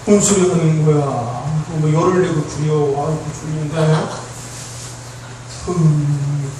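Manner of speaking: low, subdued grumble; bored sleepy listener. Acted. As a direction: imitate someone tuning out and nodding off.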